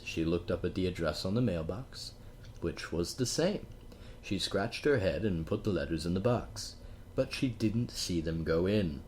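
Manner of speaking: plain reading voice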